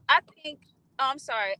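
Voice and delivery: ordinary talk, nothing marked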